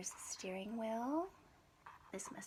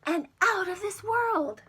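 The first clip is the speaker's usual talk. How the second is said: much higher and louder, rising to a climax; thrilling launch, building to a cry; excited building narration; 8 semitones higher, 17 dB louder than usual. A narrator's reading. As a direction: blast off with the rocket.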